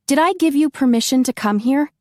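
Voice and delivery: indignant demand to the intruder. Acted. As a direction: demand to know why he came. Heroine startled and indignant.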